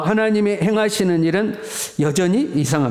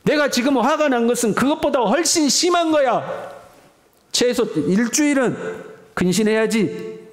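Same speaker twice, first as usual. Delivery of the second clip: God scolding; 7 semitones higher than usual. Retold or imitated. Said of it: "하나님이 이렇게 말씀하고".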